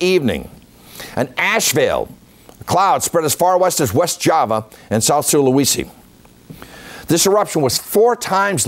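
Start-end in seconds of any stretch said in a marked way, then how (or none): none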